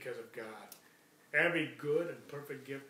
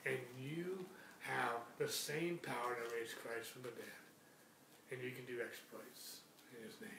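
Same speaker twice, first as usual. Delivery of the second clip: ordinary delivery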